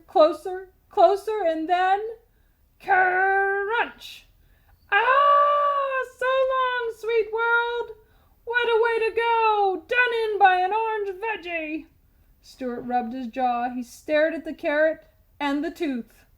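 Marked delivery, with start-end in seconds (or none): 4.9-11.9 melodramatic death. A narrator's reading. Dying tooth's farewell.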